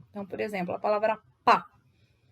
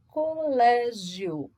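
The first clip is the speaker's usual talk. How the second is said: slow syllable demo; 4 dB louder, much slower than usual. Shown as a demonstration. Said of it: "paroxítona terminada em ditongo".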